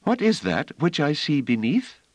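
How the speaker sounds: put-on high, trembling voice of a frightened girl; a scared child asking, voice shaking. A narrator's reading. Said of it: "Her voice trembled".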